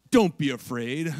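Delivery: a many-eyed angel booming gently; mock spooky creature calm; eerie angel. Eyeball-covered angel showing up.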